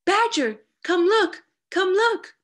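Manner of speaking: high, loud, urgent call; excited crying out. A narrator's reading. Friends at Badger's door, excited high calling. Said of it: "cried Red Squirrel".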